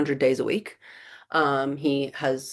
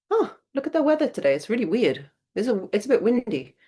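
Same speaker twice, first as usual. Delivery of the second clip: high, light, airy small talk about the weather; breezy unbothered act; 8 semitones higher than usual. Shown as a demonstration. Coach demonstrating a wife ignoring her sulking husband, breezy and high. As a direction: chirp it out like nothing is wrong. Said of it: "You act completely not dysregulated".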